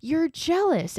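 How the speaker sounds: high-pitched mock teasing. Polly smiles at upset May, lightly mocked teasing. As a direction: imitate a gleeful taunt, pitched well up. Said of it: "she smiles, and she's like".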